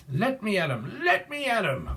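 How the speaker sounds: high, swooping cartoon puppy voice; squeaky, eager cartoon challenge; Scrappy Doo. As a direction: play a tiny dog spoiling for a fight. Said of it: "is it Scrappy Doo?".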